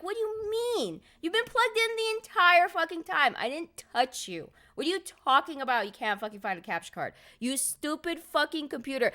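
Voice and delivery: high, loud exasperated rant; ranting at computer. Retold or imitated. Retelling her rant at the broken computer, exasperated. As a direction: yell at the machine like it's a person. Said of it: "I'm like".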